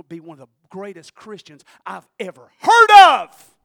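slow and loud, each word landing; loud emphatic praise. Retold or imitated. Telling the angry man his father was great, slow and loud. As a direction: proclaim it like good news.

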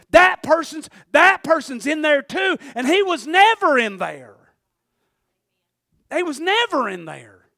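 loud, fervent preaching; preaching at full force. Real, not acted. Preacher insisting to congregation, fervent and loud. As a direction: drive the point home to the congregation.

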